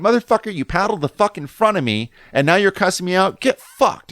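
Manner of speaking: retold angry rant, pitched high; acted-out indignant swearing; profane outburst. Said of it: "there's a bunch of profanity coming".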